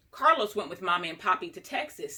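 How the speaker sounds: ordinary delivery, nothing marked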